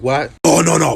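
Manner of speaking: usual speaking voice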